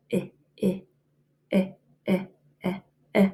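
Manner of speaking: repeated short eh sounds; vowel sound drill. Shown as a demonstration. Teacher drilling the vowel sound for students, steady beats. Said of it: "Your word is well".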